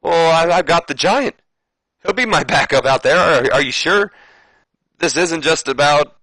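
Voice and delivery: hammed-up Flair questioning motives; Ric Flair voice. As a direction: push back on the captain. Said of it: "And Rick goes".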